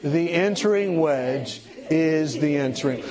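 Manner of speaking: slow, deliberate phrase for repeating; measured, spaced-out teaching line; call-and-repeat slogan. Shown as a demonstration. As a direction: lead the room through it slowly. Leading audience to repeat a phrase.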